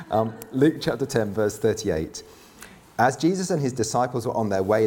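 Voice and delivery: normal talking voice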